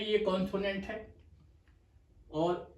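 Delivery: ordinary talk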